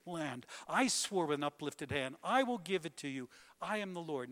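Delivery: loud, punched preaching of God's promises; thundering divine declaration. Acted. Preacher declaring God's promises to the congregation, loud and thundering. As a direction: hammer each "I will" like a proclamation.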